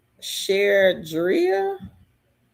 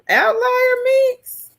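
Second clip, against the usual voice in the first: much higher, louder and slow; surprised high question; 5 dB louder, slower than usual. Real, not acted. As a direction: pitch it up in disbelief. Surprised question about platform.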